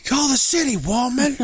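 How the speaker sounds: very high hammed-up scream; mock scream call. Joking along with the storyteller's imitation, high mock scream. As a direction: screech the order, playing it up. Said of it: "That is scream call".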